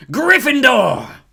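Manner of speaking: very high, loud, slow proclamation; hat shouting. A narrator's reading. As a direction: call the house out to the hall. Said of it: "the hat had shouted".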